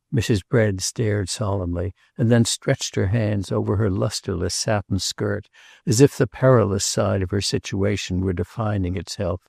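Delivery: ordinary delivery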